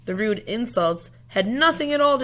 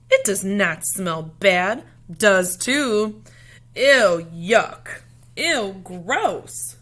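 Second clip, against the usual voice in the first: loud, slow, huffy bickering; two kids shouting back and forth; childish quarrel; 7 dB louder, much slower than usual. Acted.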